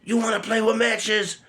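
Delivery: very high, strained mother's voice, scolding; furious mom. Retold or imitated. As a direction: imitate his mother shrieking over the matches. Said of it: "So she's".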